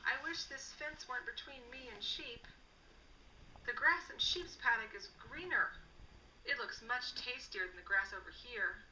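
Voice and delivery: plain reading voice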